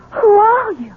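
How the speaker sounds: tearful, high frightened question; shaken, near tears. Acted. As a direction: ask through rising panic. Sees unknown woman in room.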